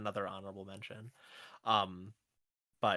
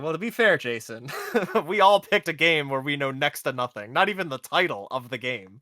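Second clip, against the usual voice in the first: laughing through it; 3 semitones higher, 8 dB louder than usual. Retold or imitated. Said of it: "being devil's advocate here and being like".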